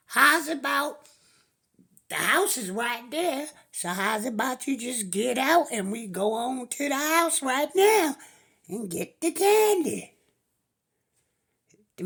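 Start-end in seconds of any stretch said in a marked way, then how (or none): 0.1-10.1 put-on higher and louder, the kid pressing his deal; pushy boy. Boy haggles with the stranger, higher and louder. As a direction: push hard like a cheeky kid haggling.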